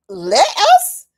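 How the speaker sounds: shot up high and loud in disbelief; incredulous scoff. Real, not acted. To the naysayers, scoffing at their permission, incredulous high squeak. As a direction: scoff at the idea of needing permission.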